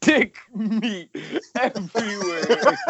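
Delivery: high, laughing through the line. Retold or imitated. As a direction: crack up while quoting it.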